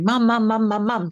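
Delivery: lightly voiced kid tugging for attention; a hint of an insistent little boy; mild repeated calling of mom; child nagging mom. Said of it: "trying to get her attention".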